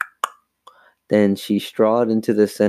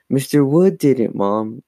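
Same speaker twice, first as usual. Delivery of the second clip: higher, mildly heated insistence; 4 semitones higher, 3 dB louder than usual. A narrator's reading. Chris blames the dummy to Mom, mildly heated. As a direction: press the accusation, a touch heated. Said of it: "Chris said heatedly".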